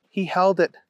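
plain reading voice

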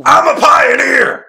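high-pitched, drawn-out full-throated yell; mock roar. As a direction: bellow it slowly at the top of your voice. Mock shout as screaming pundit.

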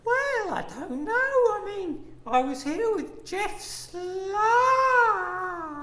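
flirty woman voice. Acted. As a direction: greet them like a coquettish lady.

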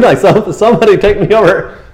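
high, loud comic appeal; helpless mock plea. Blind man needing a guide to the pool, high mock plea. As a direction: call out for help, half laughing.

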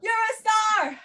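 high, drawn-out group call; rousing cheer. Shown as a demonstration. Coach says it along with the class, rousing cheer. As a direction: sing out the praise with everyone. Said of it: "I want you to say it with me".

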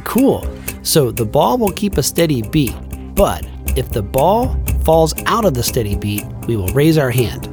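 ordinary talk

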